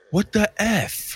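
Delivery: high, drawn-out kid's outburst, imitated; high-pitched mock indignation, stretched slowly; loud outburst. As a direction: imitate a shocked seven-year-old blurting it out. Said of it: "very loudly and goes".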